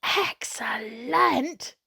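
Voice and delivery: breathy whisper, slow; hushed slow whisper of delight; breathed. Acted. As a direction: whisper it in awe. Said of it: "I breathed".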